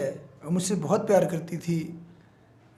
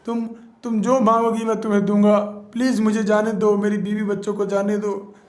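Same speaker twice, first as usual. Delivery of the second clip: higher, fast frantic pleading; begging; 4 semitones higher, faster than usual. Acted. Lagandev begs the witch to let him go home. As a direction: beg to be let go. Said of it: "Lagandev कहता है".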